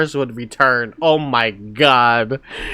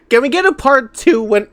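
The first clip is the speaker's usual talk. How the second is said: excited plea; 10 semitones higher, 6 dB louder than usual. Real, not acted. Begging for more story.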